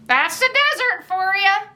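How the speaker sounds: put-on high-pitched old-timer voice; old shopkeeper.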